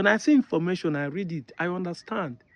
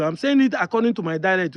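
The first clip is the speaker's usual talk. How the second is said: ordinary talk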